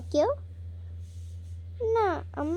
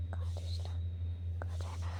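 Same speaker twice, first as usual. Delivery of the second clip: কাঁদিস না breathed in a much softer whisper; 14 dB quieter than usual. Shown as a demonstration. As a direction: hush her gently, barely voiced. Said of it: "repeats then drops voice".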